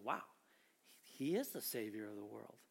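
low, soft wonder; hushed awe. Acted. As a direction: voice their dawning awe, low and quiet. Voices the people's dawning awe.

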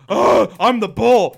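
slow, loud, puffed-up mascot voice; mock proud mascot. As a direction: announce it slowly like a proud bull. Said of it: "you'd be like".

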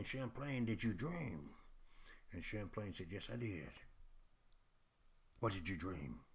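hushed exchange. Retold or imitated. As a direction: draw listeners in quietly. Hushed dream questioning.

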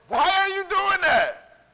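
high, loud, slow disbelief; incredulous. Retold or imitated. Caller baffled to hear he is building Lego, incredulous. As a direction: stretch it out, totally baffled.

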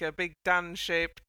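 normal talking voice

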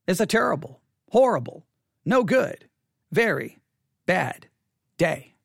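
a pause after each of "terrible, horrible, no good, very bad day"; deliberate pauses. Shown as a demonstration. Pastor asking congregation about their Sunday, "terrible, horrible, no good, very bad day" in separate pieces. Poses the question, word by word.

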